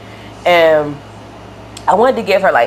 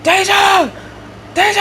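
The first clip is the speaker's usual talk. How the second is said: high-pitched imitated scream of a name. Boyfriend yells from down the hall, screaming. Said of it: "he, he screams".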